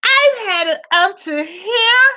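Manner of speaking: high, slow put-on wife voice, fed up; mock fed-up wife. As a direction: whine it like a wife at her limit. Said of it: "she said".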